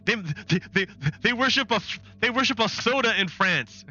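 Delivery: high-pitched, scandalized pundit; Newsmax. Acted. News channel misreading what the French worship, mock-scandalized. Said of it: "Newsmax would be like".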